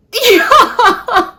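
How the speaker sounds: loud, high-pitched burst of laughter; real laughter. Real, not acted. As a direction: crack up in disbelief. Laughing at his outrageous proposal.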